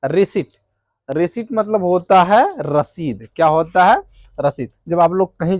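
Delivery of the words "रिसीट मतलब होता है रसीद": normal talking voice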